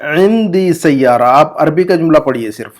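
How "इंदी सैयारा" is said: इंदी Saiyaara drawn out slowly for students; slow model reading. Shown as a demonstration. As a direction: read it slowly so they can repeat. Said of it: "मैं pause देकर". (धीमे धीमे पढ़कर दिखाना)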